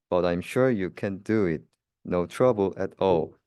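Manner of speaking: plain reading voice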